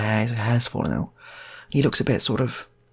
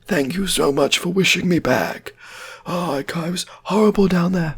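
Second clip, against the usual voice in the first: returned dead man's voice; 9 semitones higher, 4 dB louder than usual. Acted. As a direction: draw it out in an eerie, pitched-up moan. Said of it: "that is what he's speaking like".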